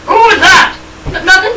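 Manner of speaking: usual speaking voice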